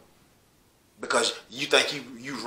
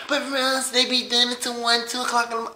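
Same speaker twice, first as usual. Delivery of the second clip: much higher, whiny mock complainer voice; a hammed-up, higher whine parodying the complaint; mocking whiny neighbor; 11 semitones higher than usual. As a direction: squeak it like a petty, nosy neighbor. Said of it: "But no, you got to complain".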